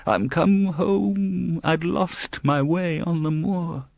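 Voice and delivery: high, very slow sobbing plea of a ghost; sobbing ghost child. A narrator's reading. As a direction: wail it slowly like a lost child spirit. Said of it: "As it spoke".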